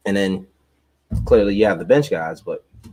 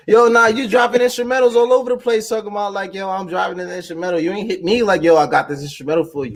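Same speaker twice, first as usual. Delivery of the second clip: mock teasing; 10 semitones higher than usual. Teasing friend, mocking his boasts.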